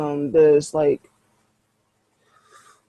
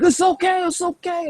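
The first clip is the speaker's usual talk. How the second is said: high, placating reassurance; calm the neighbors down, high; mock soothing; 12 semitones higher than usual. Kinfolk placating the angry neighbors, high mock soothing.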